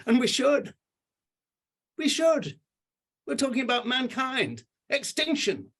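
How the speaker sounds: high, impassioned plea, voice climbing; emotional. Real, not acted. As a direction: pour real feeling into it. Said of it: "I get very emotional about this".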